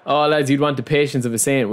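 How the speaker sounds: ordinary talk, nothing marked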